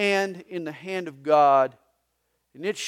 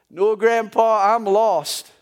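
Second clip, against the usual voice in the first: lightly voiced little grandson, higher; a hint of a lost little boy; 4 semitones higher, 11 dB louder, slower than usual. Grandson answering grandpa in a light boy voice.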